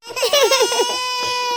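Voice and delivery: put-on child's wail. Child bursts into tears.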